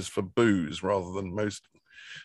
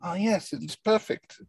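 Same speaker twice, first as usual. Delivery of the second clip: raised, delighted voice; excited; 10 semitones higher, slower than usual. Real, not acted. [name] unpacks the fridge inserts, plainly thrilled. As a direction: savour the new parcel with glee. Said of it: "look at that excitement".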